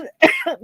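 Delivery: sudden choking cough; coughing fit. Real, not acted. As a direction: cough hard, choking on phlegm. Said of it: "I'm choking".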